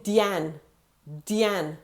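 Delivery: Diane as "dee-AHN"; French pronunciation of Diane. Shown as a demonstration. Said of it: "Since two thousand twelve".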